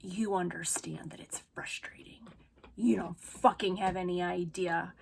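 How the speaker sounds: hissed, unvoiced, seething; low whispered fury; angry whisper. Real, not acted. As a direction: drop to a furious whisper. Furious rant drops to hiss.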